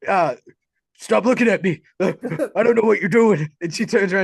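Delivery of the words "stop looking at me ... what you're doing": louder, higher fluster; flustered killer. Retold or imitated. To the girl, a rattled, raised killer voice. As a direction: snap at her, rattled and raised. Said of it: "And he's like".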